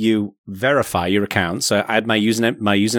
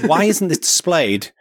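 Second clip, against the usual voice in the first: exasperated; 7 semitones higher, slower than usual. Retold or imitated. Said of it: "I was like".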